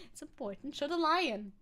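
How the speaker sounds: ordinary delivery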